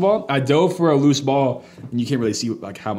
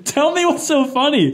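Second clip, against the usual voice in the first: high, drawn-out plea, retold; mock exasperated demand; 11 semitones higher than usual. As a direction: act out pressing the giggler for answers. Retells own demand to laughing brother.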